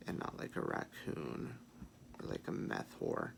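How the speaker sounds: hushed unvoiced whisper. Real, not acted. Makeup vlogger whispering an aside to viewers. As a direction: drop to a whisper mid-tutorial.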